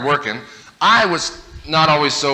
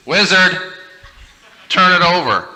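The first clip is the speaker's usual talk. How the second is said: put-on higher, louder kid giving orders; bossy brother; 5 semitones higher, 5 dB louder, slower than usual. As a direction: tell him like a smug little brother. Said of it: "And he said".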